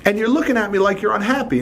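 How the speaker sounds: high, baffled retelling of his own words; incredulous boss. Retold or imitated. Boss to unhappy employee after a raise, high incredulous. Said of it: "I said".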